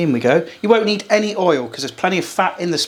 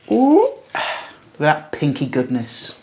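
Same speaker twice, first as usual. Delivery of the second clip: slow, savouring ooh; admiring; 4 semitones lower, much slower than usual. Real, not acted. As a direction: drool over it slowly. Admiring the opened meat.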